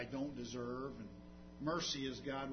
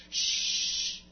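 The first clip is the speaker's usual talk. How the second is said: shushing; 4 semitones higher, 6 dB louder, slower than usual. Retold or imitated. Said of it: "were telling him that".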